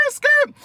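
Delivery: high, loud tire skid sound; tire screech. Retold or imitated. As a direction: screech it like brakes slamming. Imitating car tires screeching.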